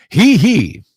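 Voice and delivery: loud, high hee-hee in a narrator voice; Delver Shorts voice. Shown as a demonstration. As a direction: belt out the squeal as a Shorts host. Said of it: "you want Delver Short voice".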